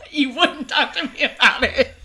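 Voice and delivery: loud, high, laughing retort. Real, not acted. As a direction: blurt it out through laughter. Teasing friend, both laughing.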